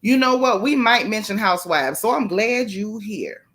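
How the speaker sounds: ordinary delivery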